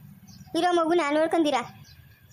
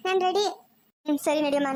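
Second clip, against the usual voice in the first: normal talking voice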